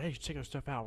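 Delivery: retold whisper. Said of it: "two groups we heard whispering about us".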